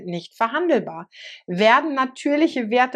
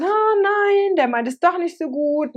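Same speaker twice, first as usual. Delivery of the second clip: mock dismay; 7 semitones higher than usual. Voicing the victim realising.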